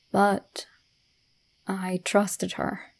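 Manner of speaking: hushed, slow confession; hushed creeping horror. A narrator's reading. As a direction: drop to a near whisper. Confessing going into the dark.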